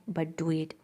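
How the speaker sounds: whispered order. Acted. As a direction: let it fall to a whisper. Duke's line read aloud.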